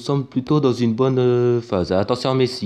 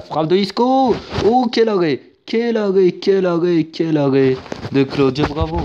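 high, loud "quel arrêt" then settling; excited save call; 4 semitones higher, 3 dB louder than usual. Acted. Commentator hailing the goalkeeper's save, excited then calming. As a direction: shout the save, then come down.

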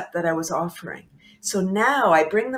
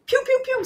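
high-pitched "pew pew pew" effect; imitated squirting sound. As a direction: playfully mimic little shots firing, very high. Imitates brain firing off love drugs.

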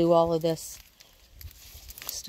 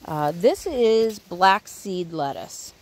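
ordinary talk, nothing marked

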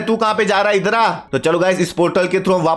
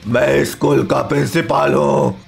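slow, low menacing principal; low villain voice, drawn out; 4 semitones lower, slower than usual. Acted. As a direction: announce yourself as the evil school boss. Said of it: "तेरे principal ने".

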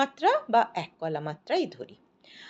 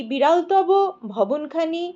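raised, louder "বিরলতব", a break, then "ভবনখানি"; high "বিরলতব", short pause, high "ভবনখানি"; breath pause after বিরলতব; 6 semitones higher, 4 dB louder, slower than usual. Shown as a demonstration. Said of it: "বিরলতব যেখানে".

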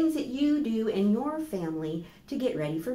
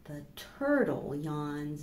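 put-on reading to children; slower than usual. Teacher reading a bedtime book to children, gently.

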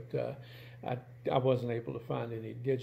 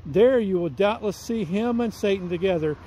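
put-on a measured, louder reading of an old text; reading aloud; 7 semitones higher, 10 dB louder than usual. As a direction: read the old book to the congregation. Reads old Puritan text aloud.

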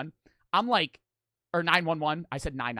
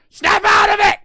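high, very loud yell; acted-out shout; 15 dB louder than usual. Friend's advice to shake the tripping man, acted-out shout. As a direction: yell it hard to jolt someone awake.

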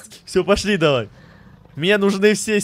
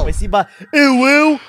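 ordinary talk

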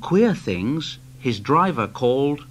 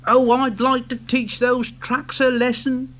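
Thomas voice; 9 semitones higher than usual. Acted.

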